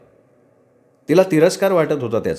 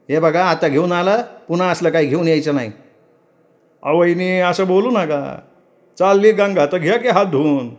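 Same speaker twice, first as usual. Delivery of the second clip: higher, complaining farmhand; servant Saravanya; 7 semitones higher than usual. Acted. Servant Saravanya complaining to Raghu about the load, lightly voiced. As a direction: grumble about the heavy sack.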